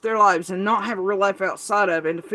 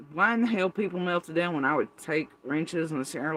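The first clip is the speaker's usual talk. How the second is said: normal talking voice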